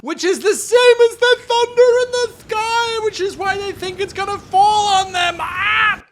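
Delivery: high, loud panicked cry of falling sky; mock panic. Elves fearing the water will fall on them, loud panicked cry. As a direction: shout it like the sky is falling on you.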